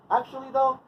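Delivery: high chant for others to repeat; demonstrated call-out. Shown as a demonstration. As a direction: pitch it high and hang on the last word. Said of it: "say it with me".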